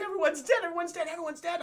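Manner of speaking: high, frantic imitation of a crying girl; hammed-up high distress; mock distraught wail. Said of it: "she's just crying".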